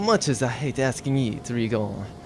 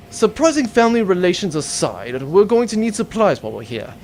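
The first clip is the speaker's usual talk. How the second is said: normal talking voice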